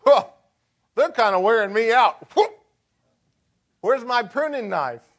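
annoyed grumble. Pretend. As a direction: grumble like a tired pastor who wants to cut them off. Mocking a weary pastor.

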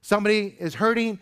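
high, loud, driving preacher's build; preaching at full force. Acted. Pastor rousing his congregation, preaching at full force. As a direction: lift the room, pushing each line harder.

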